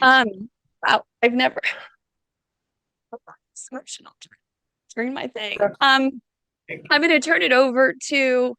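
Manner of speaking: fighting back crying mid-sentence; choked up. Real, not acted. Speaker overcome with emotion telling the panel about his calling, choked up. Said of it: "I promised Charles I wasn't gonna cry".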